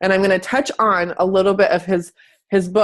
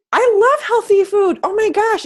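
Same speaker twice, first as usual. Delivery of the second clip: much higher, gushing mock delight; 12 semitones higher, 3 dB louder than usual. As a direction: squeal it like a happy convert. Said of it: "it's like".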